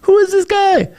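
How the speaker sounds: high, rising disbelief, asked twice; who is this guy, half shouted in mock surprise; baffled outburst. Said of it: "and I was like".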